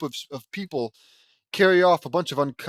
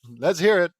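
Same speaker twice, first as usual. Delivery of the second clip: raised, higher and emphatic; louder retake; 4 semitones higher, 8 dB louder than usual. Acted. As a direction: give the line a louder, livelier push. Redoes the line with more energy.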